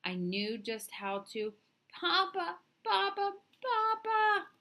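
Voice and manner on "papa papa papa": put-on panicked child calling, rising much higher and louder; frightened cry. Injured boy calling out to Papa, panicked rising cries. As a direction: scream for Papa in terror. Child hurt, crying for father.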